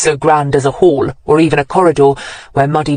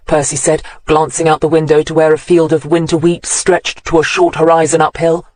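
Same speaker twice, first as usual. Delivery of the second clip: plain reading voice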